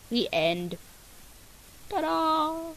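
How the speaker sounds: usual speaking voice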